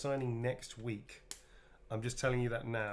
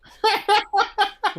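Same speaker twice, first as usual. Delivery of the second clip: loud high laugh; 17 dB louder than usual. Real, not acted. Laughs at own bad accent.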